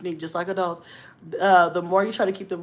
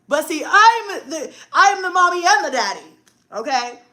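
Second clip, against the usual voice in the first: usual speaking voice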